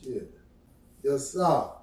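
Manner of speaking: usual speaking voice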